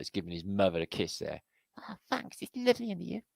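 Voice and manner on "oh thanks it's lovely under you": very high soft little voice; baby hippo voice. Pretend. Baby hippo thanking its mother, tiny high voice.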